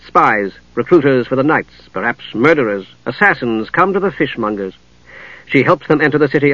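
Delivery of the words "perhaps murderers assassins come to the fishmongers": ordinary delivery, nothing marked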